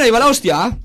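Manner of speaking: loud, high exaggerated Basque accent. Shown as a demonstration. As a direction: show off a hammed-up Basque accent. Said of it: "El vasco se me da muy bien".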